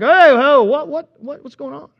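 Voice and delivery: loud, high burst that tails off; startled protest. Acted. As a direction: react to a jab in the ribs. Acts man jolted by elbow.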